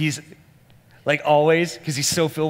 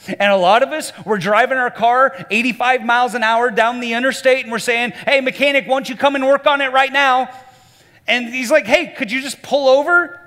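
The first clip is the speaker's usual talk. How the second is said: loud, high-pitched preacher in full flow; full-force preaching; 12 semitones higher, 8 dB louder than usual. Real, not acted. Preacher illustrating impatient drivers to a congregation, loud impassioned preaching. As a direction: drive the illustration home at the top of your voice.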